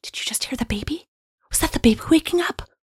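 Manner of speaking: frantic, frazzled stage whisper; whisper yell. Acted. Frazzled new parent shushing partner over the baby, whisper yelling. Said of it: "spits into the sink and whisper yells".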